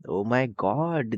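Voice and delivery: slow, stretched-out lament; long-drawn "oh my God" reaction; drawn-out dismay. Acted. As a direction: drag out every word in mock dismay. Narrator reacts to the friends fighting.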